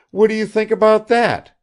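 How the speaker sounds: high, loud hostess question; Kukshina asks eagerly. A narrator's reading. Kukshina turns to Bazarov, high and eager. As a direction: press him for agreement.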